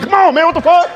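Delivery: high, exasperated cousin; cousin's voice. Retold or imitated. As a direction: pitch it way up in disbelief.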